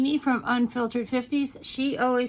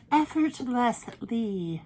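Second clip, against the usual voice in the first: drawn out; 2 semitones higher, 4 dB louder, much slower than usual. Shown as a demonstration. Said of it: "drawn out word repeated".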